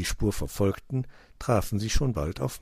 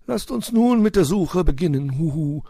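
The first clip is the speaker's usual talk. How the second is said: loud, high owl voice with a hoot; owl uncle; 9 semitones higher, 8 dB louder than usual. A narrator's reading. Uncle owl opening the search, hooting and rallying. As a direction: propose it as a hooting storybook owl. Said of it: "schlug Onkel Uhu vor".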